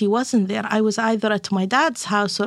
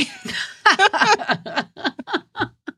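high burst of laughter; real amused laugh; 10 semitones higher than usual. Real, not acted. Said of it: "She came at him".

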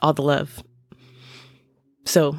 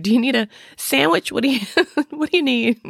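higher, quick doting mom offering food, laughing midway; fussing comfort mom; 7 semitones higher than usual. Retold or imitated. Playing a doting parent offering her grieving kids food, higher and quick. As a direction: rush out the offers like an eager comfort-feeder.

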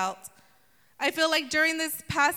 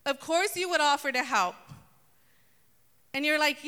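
usual speaking voice

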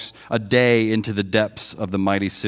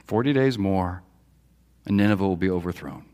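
a lightly voiced prophet, low and softer; Jonah's sermon, understated and grim; a hint of a doom-laden prophet; 4 semitones lower, 4 dB quieter than usual. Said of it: "And then Jonah says".

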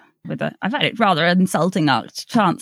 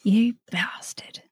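slow, hushed echo; whisper; 3 semitones higher, 5 dB quieter, much slower than usual. Acted. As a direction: breathe it back slowly. Echoing the punchline.

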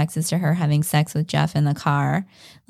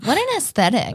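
mock-impressed, stretched out; sarcastic; 7 semitones higher than usual. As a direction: tease it with fake awe. Mocking Adam's apartment.

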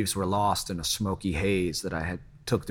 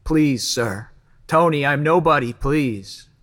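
raised, pleading outburst; desperate plea; 5 semitones higher, 5 dB louder, slower than usual. A narrator's reading. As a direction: beg him to stop, voice climbing. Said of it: "managed to get some words out".

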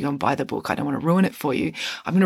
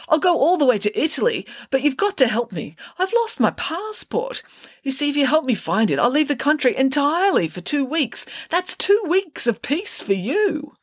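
put-on oversell the bargain like a desperate teenager; pleading Parker; 10 semitones higher than usual.

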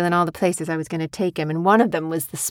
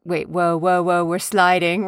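usual speaking voice

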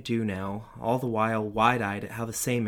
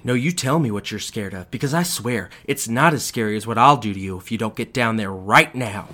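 loud, menacing threat building louder; threatening father; 10 dB louder than usual. A narrator's reading. Father threatening his child to go downstairs. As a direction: lean in and threaten.

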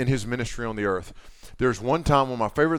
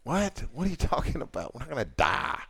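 puzzled Jesus; 2 semitones higher, 4 dB quieter than usual. Retold or imitated. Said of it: "Jesus is like".

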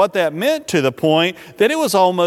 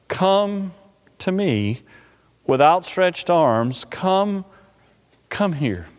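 tender invitation; slower than usual. Acted. Preacher as Jesus calling the weary, tenderly. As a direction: invite them gently, leaving space after each phrase.

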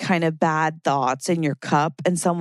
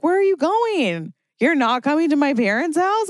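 mock confusion; 9 semitones higher than usual. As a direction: plead with a departing car. Car leaving, mock betrayal.

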